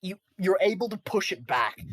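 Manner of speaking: normal talking voice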